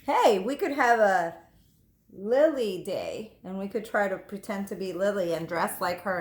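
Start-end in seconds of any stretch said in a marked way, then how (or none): none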